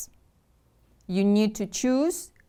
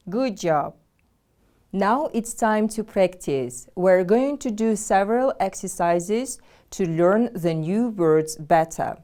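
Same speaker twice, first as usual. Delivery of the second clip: ordinary delivery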